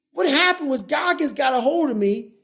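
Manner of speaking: ordinary delivery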